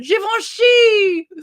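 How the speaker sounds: high, loud, drawn-out mock shout; random public yell. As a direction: belt Givenchy out across a store. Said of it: "you being in Walmart and going".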